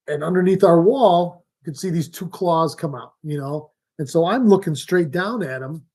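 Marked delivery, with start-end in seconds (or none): none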